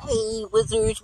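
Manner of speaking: ordinary talk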